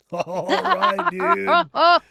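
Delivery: loud, high laughing whoop; laughing outburst. Real, not acted. Reacting to the threat with a loud laughing whoop. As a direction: laugh it out big.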